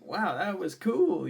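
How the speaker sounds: high, loud wowed reaction; excited. Retold or imitated. Worker reacting to a bubbly high five, excited. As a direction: re-enact being thrilled, voice leaping up. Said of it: "me being excited".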